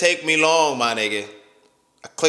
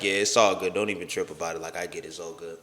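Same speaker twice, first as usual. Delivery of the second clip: put-on upset man telling his girlfriend it's fine, lower and softer; monotone; 9 semitones lower, 6 dB quieter, faster than usual. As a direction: lower and much softer, fading flat. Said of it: "I'm real monotone".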